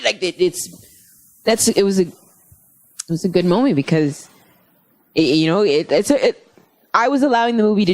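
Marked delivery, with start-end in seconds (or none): none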